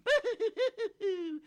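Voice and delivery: very high, loud comic boo-hoo wail; cartoon sobbing from inside a tree; fairy boo-hoo sob. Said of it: "sounded like they were very, very sad".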